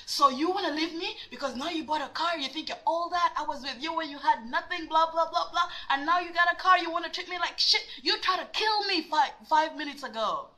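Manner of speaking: high, ranting retold outburst; worked-up retold accusation; crazy tirade. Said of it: "I was crazy".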